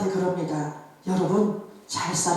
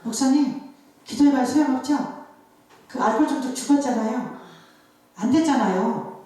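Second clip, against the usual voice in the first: higher-pitched imitation of doubting neighbours; scoffing neighbours; 4 semitones higher than usual. Neighbours telling the pastor his prayers were useless, scoffing. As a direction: mimic villagers needling their pastor. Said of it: "동네 사람들이 뭐라 그러냐면".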